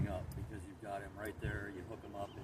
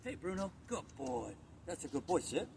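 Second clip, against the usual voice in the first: high, excited praise for a dog; excited dog-trainer voice; 8 semitones higher, 4 dB quieter than usual. Shown as a demonstration. Trainer showing owner how to excite the dog, high excited voice. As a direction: pitch it up to keep the dog keen. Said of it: "keep them excited about".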